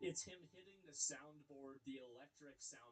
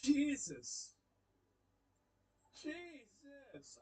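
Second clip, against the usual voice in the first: exasperated Jesus; 11 semitones higher, 13 dB louder, much slower than usual. Real, not acted. Reacting to a cringe clip.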